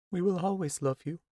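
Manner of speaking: put-on a mother's last words to her child; grieving mother tells her daughter she is loved; tender mother. A narrator's reading. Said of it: "her mother said".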